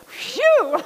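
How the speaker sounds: worn-out angel. Pretend. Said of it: "They'll be like".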